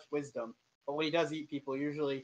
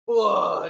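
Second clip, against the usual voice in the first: loud retching ugh; 5 semitones higher, 13 dB louder than usual. Acted. Dog about to vomit.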